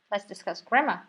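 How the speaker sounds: normal talking voice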